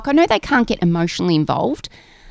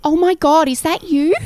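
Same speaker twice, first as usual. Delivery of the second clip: high, louder gasp of recognition; starstruck fan imitation; 11 semitones higher, 4 dB louder than usual. Deli worker recognising a customer, mock-starstruck. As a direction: imitate an amazed fan spotting someone.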